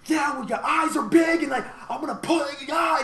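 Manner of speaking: loud obnoxious rapper. Retold or imitated. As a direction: yell it right in their face. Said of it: "he's just talking at you really loud and obnoxious".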